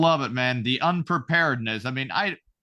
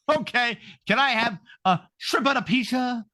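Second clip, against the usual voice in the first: mock wife shout; 7 semitones higher than usual. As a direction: yell it like a bratty wife.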